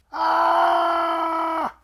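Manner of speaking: piercing high terrified scream; dragon's scream. Acted. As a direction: shriek in sudden terror. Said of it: "screamed the dragon".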